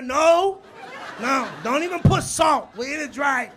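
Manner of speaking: loud, high shouted refusal; mock shout. Friend at the movies yelling no butter, loud mock shout. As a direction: yell it like a panicked friend.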